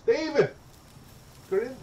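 loud, high call of a name. Real, not acted. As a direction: call sharply to a companion. Calls out to his companion.

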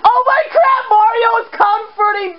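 high, excited outburst; delighted amazement. Real, not acted. Player seeing Mario comfort Bowser, high delighted amazement. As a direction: exclaim it high, amused and amazed.

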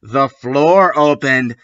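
ordinary delivery, nothing marked

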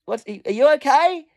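high, concerned call from a distance. Retold or imitated. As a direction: call over to check on them. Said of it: "I called out to them".

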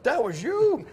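a sudden high, incredulous "that was you?"; lightly imitated disbelief, voice jumping up; mock shock of recognition; surprised. Instructor realizing who it was.

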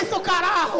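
loud, high mock-exasperated portunhol; grito exasperado. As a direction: swear back loudly in fake Spanish. Discussão gritada em portunhol. (berro agudo e exasperado em portunhol)

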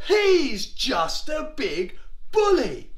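high, slow, emphatic declaration; rally the troops, word by word; David. Acted. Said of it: "David said to the Israelites".

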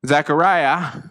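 ordinary talk

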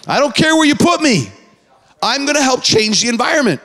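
high, driving sermon cadence; preaching at full force. Acted. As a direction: preach it to the room.

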